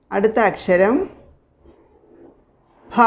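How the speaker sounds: normal talking voice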